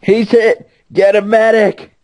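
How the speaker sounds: high urgent call for a medic; man down shout. Pretend. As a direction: shout it like a soldier down. Mock battlefield call for medic.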